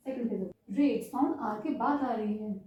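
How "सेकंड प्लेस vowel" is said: normal talking voice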